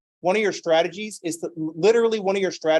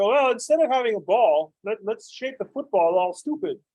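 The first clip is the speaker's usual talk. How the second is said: mock inventor; 5 semitones higher than usual. Mocking football inventors.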